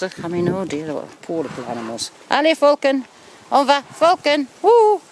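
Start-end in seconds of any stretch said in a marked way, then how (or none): 2.3-4.5 loud, high call to the dog; urging the dog. Real, not acted. 4.6-5.0 high, loud whoop; excited whoop. Real, not acted. Cheering the dog.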